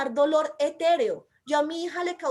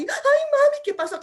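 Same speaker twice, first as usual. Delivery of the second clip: put-on high, loud wail of a scared little girl; mock child's frightened wail; crying child; 13 semitones higher, 7 dB louder than usual. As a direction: cry out like a startled child.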